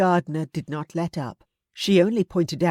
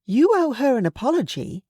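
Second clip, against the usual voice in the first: higher, firm matronly voice; stern aunt; 7 semitones higher than usual. A narrator's reading. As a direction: scold him like a frank aunt.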